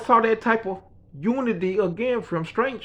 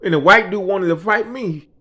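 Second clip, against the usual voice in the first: normal talking voice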